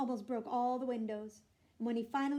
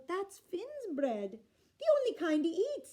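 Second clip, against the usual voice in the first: innocent wife; 7 semitones higher, 5 dB louder than usual. A narrator's reading. Unag innocently explains.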